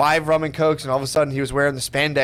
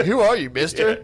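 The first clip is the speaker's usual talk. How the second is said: imitating bartender; 6 semitones higher than usual. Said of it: "the fifty-year-old bartender's like".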